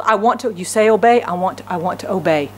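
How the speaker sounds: ordinary talk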